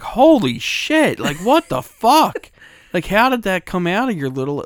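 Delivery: high, slow, loud astonished cry; shocked mom. Retold or imitated. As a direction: gasp it out like a stunned mother. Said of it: "my mother was like".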